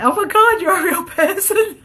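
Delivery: high, loud burst breaking into laughter; delighted, high-pitched outburst; amused exclamation. Real, not acted. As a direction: blurt it out, tipping into a real laugh. Amused reaction to a live viewer.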